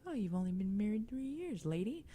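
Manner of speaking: high-pitched sceptical listener; teasing imitation of a doubter; mock scoffing.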